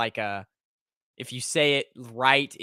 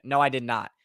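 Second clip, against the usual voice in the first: ordinary talk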